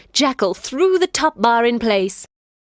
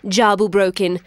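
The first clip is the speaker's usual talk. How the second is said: plain reading voice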